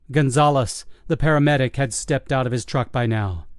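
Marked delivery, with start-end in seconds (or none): none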